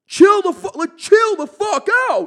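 high, very loud retold plea; acted panicked shout. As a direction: yell up at an attacker, re-enacting it. Acts his pleas from the floor.

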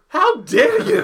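high, loud outraged laugh-shout; mock outrage. Pretending to snap at a suitor's proposal, mock outraged. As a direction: shriek it in faked offense. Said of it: "I'd be like".

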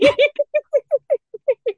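big high laugh. Real, not acted. Teacher cracking up at own joke, loud high laugh.

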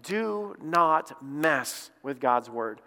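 slow, weighty stress on each word of "Do not mess"; emphatic warning. Retold or imitated. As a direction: spell out the warning word by word, very slowly. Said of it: "he's emphatically saying".

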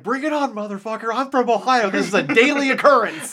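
high, loud, cocky mock challenge; tough Ohio guy. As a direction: play a fearless local who's seen it all. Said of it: "this guy's like".

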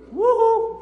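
high, loud woo-hoo like a swooping plane; loud high whoop; excited whoop. Acted. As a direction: cheer the plane down. Mimes plane diving with kids.

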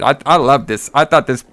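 ordinary talk, nothing marked

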